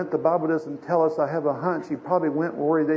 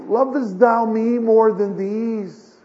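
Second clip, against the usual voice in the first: slow, raised, louder solemn question; Jesus asking insistently; 7 semitones higher, 7 dB louder, slower than usual. Acted. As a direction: draw it out high and loud, pressing for an answer. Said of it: "he asks him the second time".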